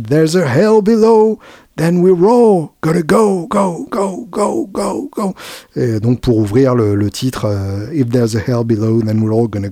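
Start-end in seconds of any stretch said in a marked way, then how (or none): 0.1-5.3 preaching. Retold or imitated. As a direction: proclaim it like a gospel preacher. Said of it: "qui prêche".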